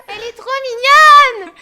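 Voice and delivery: high, loud gushing shout; excited squeal. Real, not acted. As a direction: squeal with delight. Gushing over cute character. (cri d'enthousiasme)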